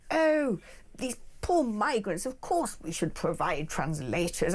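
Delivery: slow, simpering metropolitan do-gooder; mock liberal elite. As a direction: put on exaggerated pity, mocking. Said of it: "the liberal elites in metropolitan cities".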